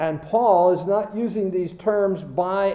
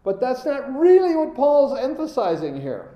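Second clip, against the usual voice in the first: higher, louder preaching build; rising preaching; 4 semitones higher, 4 dB louder than usual. Acted. Preacher pressing home the meaning to the class. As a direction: build the point with fervor.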